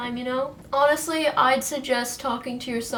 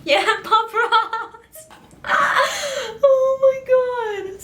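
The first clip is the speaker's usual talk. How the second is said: laughing hard; 13 semitones higher, 5 dB louder, much slower than usual. Real, not acted. Laughing fit at own joke.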